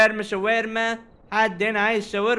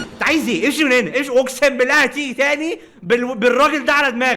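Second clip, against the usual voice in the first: louder and higher, threatening; angry shout; 6 semitones higher, 6 dB louder than usual. Acted. Shopkeeper yelling at a shady customer to get lost. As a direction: throw him out with a threat.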